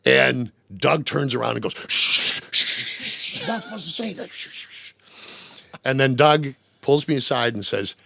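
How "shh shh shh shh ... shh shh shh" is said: whispered shh, urgent and secretive; hushed shushing. Retold or imitated. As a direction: hush someone before they spill the secret. Doug hushing a toast.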